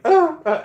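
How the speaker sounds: high squeaky yelps; little panicked squeals; mock yelps. Will flustered on couch.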